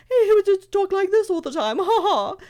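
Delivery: high, loud hammed-up posh English chatter with a laugh; mocking posh English. Mocking a posh Minecraft streamer's fake English voice, high and loud. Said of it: "his whole thing was just speaking".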